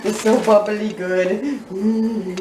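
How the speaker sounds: usual speaking voice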